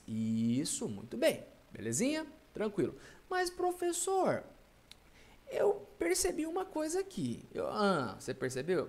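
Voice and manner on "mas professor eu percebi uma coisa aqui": high-pitched pupil piping up; puzzled student. Acted. Student tells the teacher they noticed something, puzzled.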